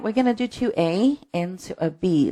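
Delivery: normal talking voice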